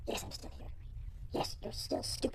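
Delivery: unvoiced whisper under the talk; hushed side remark in a whisper; whispered aside. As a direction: whisper it curtly. Unvoiced aside to someone else.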